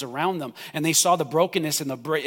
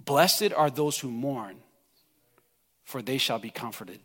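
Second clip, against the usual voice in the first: soft scripture reading; 5 semitones lower, 4 dB quieter than usual. Acted. Soft scripture after climax.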